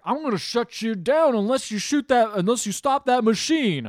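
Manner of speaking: imitating a forceful threat; threatening. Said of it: "He threatens him".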